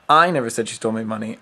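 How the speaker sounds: "I" hit loud, rest rattled off fast; stress on I. Shown as a demonstration. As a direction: punch the word "I" at the start. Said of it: "seven different ways".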